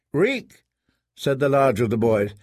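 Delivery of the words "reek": put-on higher boy's voice; a young squire taunting. A narrator's reading. Squire boy taunting the prisoner, higher voiced.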